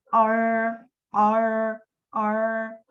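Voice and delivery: "R" held long on a steady note; sustained voiced R. Shown as a demonstration. Teacher proving R is a voiced consonant, sustained voiced R. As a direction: let each "R" ring in the throat. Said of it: "es sonora".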